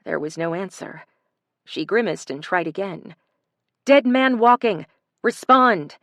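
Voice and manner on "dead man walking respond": calling loudly into the radio; urgent call. A narrator's reading. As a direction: demand an answer, loudly.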